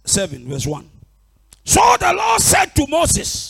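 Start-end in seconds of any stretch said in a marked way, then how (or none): 1.6-3.5 high, loud, slow declaiming; scripture proclamation. Real, not acted. Preacher reading Exodus through an interpreter, loud proclamation. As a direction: call it out to the congregation.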